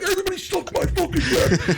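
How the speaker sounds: high shout breaking into laughter; giggly shrieked prank call; laughing prank shout. Retold or imitated. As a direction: yell it while cracking up. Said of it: "I'd be like".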